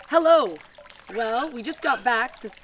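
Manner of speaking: hammed-up greeting. Reading a 1949 postcard to viewers, cheery mock greeting. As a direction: belt out the hello like a cheery letter writer.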